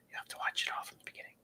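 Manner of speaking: a quick, teasing whisper; whispered tease. Real, not acted. Streamer teasing viewers who missed the start, in a quick whisper. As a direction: whisper it fast like a sly aside.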